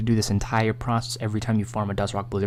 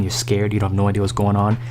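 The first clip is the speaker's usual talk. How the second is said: ordinary talk, nothing marked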